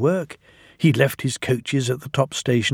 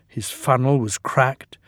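plain reading voice

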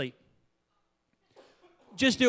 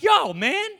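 much higher, startled and bewildered; indignant surprise; 11 semitones higher than usual. Retold or imitated. Pastor confronting a talking bush in his yard, startled. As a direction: confront a trespasser in disbelief. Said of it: "I'd be like".

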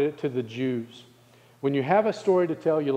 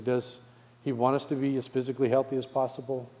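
ordinary talk

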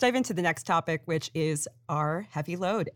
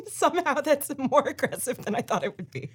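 laughing; 6 semitones higher, 4 dB louder, faster than usual. Real, not acted. Laughing at bitter coffee.

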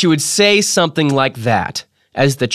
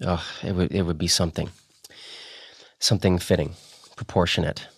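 hushed; 8 semitones lower, 8 dB quieter, faster than usual. A narrator's reading. Fading tearful close of reverie.

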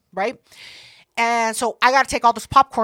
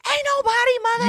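much higher, put-on defensive voice; high mock denial; 12 semitones higher than usual. Denying she is drunk to B J, high mock protest. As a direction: squeal it out, insisting.

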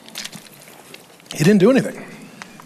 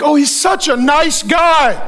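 higher, slow, sing-song "such a nice guy"; mock naive praise; 6 semitones higher, slower than usual. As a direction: play the gullible admirer, sweetly overdone. Mocks what we think.

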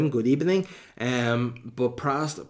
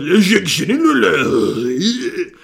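slow, higher, louder hammed-up French accent; mock French; 7 semitones higher, 9 dB louder, much slower than usual. Retrying the film title properly, a hammed-up French accent. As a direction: ham up the French title, stretching each word. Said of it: "Tried to do French".

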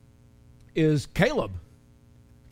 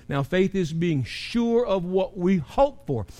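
normal talking voice

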